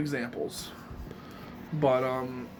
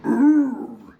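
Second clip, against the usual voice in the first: short, loud gravelly "ooh"; Thwomp groan; 8 semitones higher, 9 dB louder than usual. Shown as a demonstration. Demonstrating a Thwomp impression to viewers, loud gravelly groan. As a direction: growl out a high, forced groan. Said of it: "a thwomp impression".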